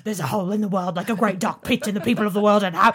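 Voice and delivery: loud, hurried bad Cockney impression; terrible Cockney accent. Mocking the actor's singing in a terrible Cockney accent. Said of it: "in his terrible Cockney accent".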